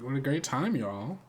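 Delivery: ordinary talk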